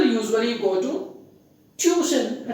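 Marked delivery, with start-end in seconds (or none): 1.8-2.5 tuition said "TYOO-shun", two syllables; common mispronunciation of tuition. Shown as a demonstration. Teacher showing how people wrongly say it, tuition as "tyoo-shun".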